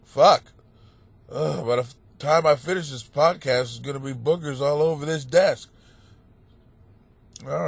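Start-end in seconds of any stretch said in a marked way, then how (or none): none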